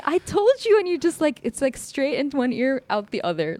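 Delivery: exasperated retold outburst, pitched high; losing it. Woman retelling telling off her forgetful date, losing it. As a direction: vent it again in a high, indignant voice. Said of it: "I lost it".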